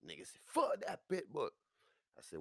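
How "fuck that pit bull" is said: high, loud bravado; mock defiant holler; defiant dismissal. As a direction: throw it out in a raised voice. Acted defiant reply.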